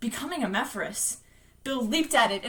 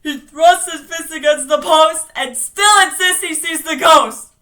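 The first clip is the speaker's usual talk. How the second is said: full-force declaimed shout; thundering; 4 semitones higher, 14 dB louder than usual. Acted. Bill defeating the monster, thundering his phrase. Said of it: "Bill thundered".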